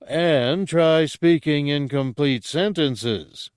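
loud, high, drawn-out scolding; yelled. A narrator's reading. Guard scolds the departing guard, yelling. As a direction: yell it slowly after someone leaving. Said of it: "Ragwa yelled".

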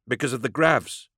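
high-pitched character voice; nervous explanation. A narrator's reading. Superintendent explains to the stranger why everyone left, nervous.

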